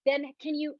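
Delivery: ordinary talk, nothing marked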